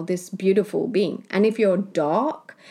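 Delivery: usual speaking voice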